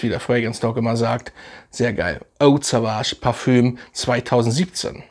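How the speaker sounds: ordinary delivery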